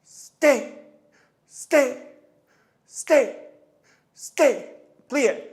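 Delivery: loud, high, punched commands; calling out cues. Real, not acted. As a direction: call each cue out sharply over the dancer's movement. Coach calling out during the step.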